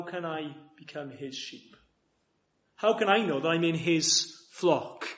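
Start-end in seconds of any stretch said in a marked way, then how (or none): none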